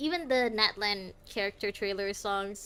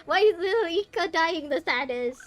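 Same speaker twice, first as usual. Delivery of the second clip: upset whine; 7 semitones higher, 5 dB louder than usual. Real, not acted. Upset at sad scene.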